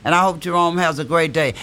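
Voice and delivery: ordinary talk, nothing marked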